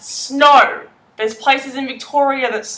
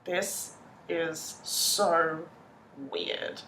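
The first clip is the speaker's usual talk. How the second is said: slow, near-whispered amazement; hushed disbelief; 7 semitones lower, 17 dB quieter, much slower than usual. Retold or imitated. Sitting in the American front seat, a slow hushed marvel. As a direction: breathe it out in wonder. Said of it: "I'm going".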